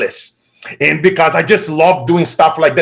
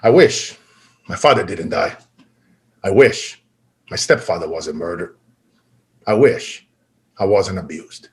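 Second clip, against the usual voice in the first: quiet heartache; 6 semitones lower than usual. Retold or imitated. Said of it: "I used to say".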